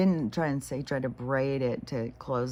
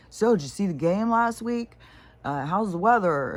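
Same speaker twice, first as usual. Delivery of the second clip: perky, higher and louder chit-chat; mock small talk; 6 semitones higher, 5 dB louder than usual. She mocks a stranger's shallow chat, higher and louder. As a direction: breeze through the weather question like a bored stranger.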